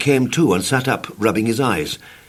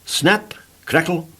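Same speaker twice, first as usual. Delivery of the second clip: ordinary delivery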